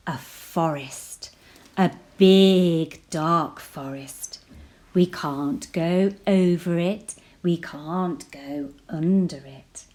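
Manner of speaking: usual speaking voice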